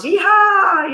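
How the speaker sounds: high, loud cowboy whoop; yeehaw cheer. Real, not acted. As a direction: whoop it out with joy. Cheering a good tarot card.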